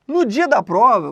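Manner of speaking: usual speaking voice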